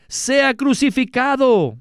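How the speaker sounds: high, slow demanding cry from the crowd; crowd shout. A narrator's reading. The whole crowd demands crucifixion.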